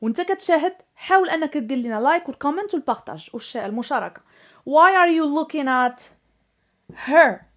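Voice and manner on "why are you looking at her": slow, with "her" punched loud; slow model sentence. Shown as a demonstration. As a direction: drag the sentence out and hit "her" hard. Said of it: "ما يمكنش نقول".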